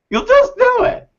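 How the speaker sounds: high excited punch; sudden high burst. Real, not acted. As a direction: fling it up high with zeal. Emphatic encouragement to listeners.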